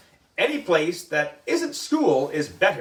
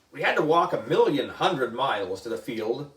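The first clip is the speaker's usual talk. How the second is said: ordinary delivery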